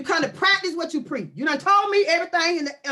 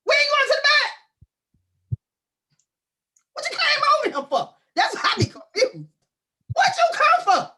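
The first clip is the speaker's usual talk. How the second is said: squealed loud and high; outraged comic screech; high mock shriek; 13 semitones higher, 5 dB louder than usual. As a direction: shriek at the visitor, hammed-up. Scolding visitor in shriek.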